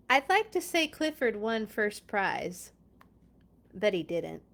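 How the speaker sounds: lively storybook read-aloud; reading to children. Acted. Reading Clifford aloud to viewers, bright storybook voice. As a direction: read it like story time for little kids. Reads picture book aloud.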